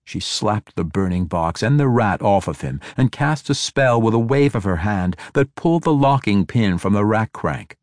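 plain reading voice

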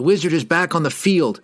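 urgent, high cry; cried out. A narrator's reading. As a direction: call out in alarm. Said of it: "he cried".

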